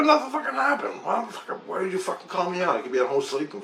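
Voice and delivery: angry outburst. Retold or imitated. Called to the river for nothing, ranting at the aliens, furious. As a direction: yell your frustration up at the empty sky.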